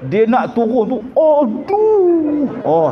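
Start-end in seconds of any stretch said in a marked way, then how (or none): 1.1-2.5 high drawn-out pained groan; mock groan. Old man groaning in prayer, high pained groan.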